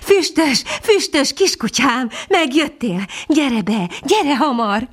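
high, joyful calls to a pet; delighted sing-out, beckoning the dog in; happy calling. A narrator's reading. Mistress calling her lost puppy home, high happy voice. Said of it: "kiáltott fel asszonyka boldogan".

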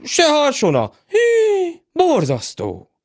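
high shocked wailing steward; aghast. Acted. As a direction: gasp it out appalled. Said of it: "hüledezett".

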